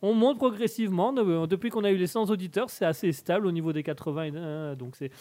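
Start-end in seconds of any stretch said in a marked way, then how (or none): none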